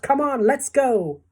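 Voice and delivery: high, loud, drawn-out rallying call; eager chorus. A narrator's reading. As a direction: urge everyone along excitedly. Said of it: "they chorused".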